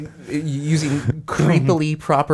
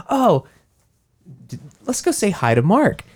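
higher, bright mock suggestion; mock cheerful teens; 6 semitones higher than usual. Siblings deciding to visit Mark, higher mock cheerful voice. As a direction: play the siblings chirping. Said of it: "They're like".

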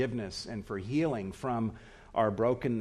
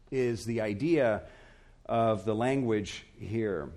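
ordinary talk

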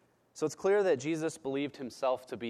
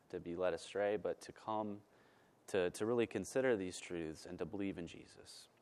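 softer, lower prayer voice; quiet prayer; 5 semitones lower, 4 dB quieter than usual. Acted. Pastor closing the sermon in prayer to the Father, softly. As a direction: pray it gently to God, unhurried.

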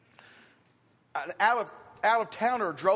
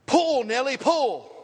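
loud, high call to a horse; 5 semitones higher, 7 dB louder, slower than usual. Retold or imitated. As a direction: shout the horse's name to make it pull. Farmer calling out to horse.

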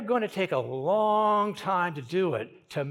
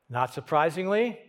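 ordinary talk

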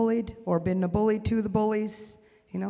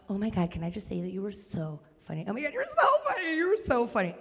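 put-on enthusiastic young fan; 4 dB quieter, faster than usual. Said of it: "enthusiastic. She was like".

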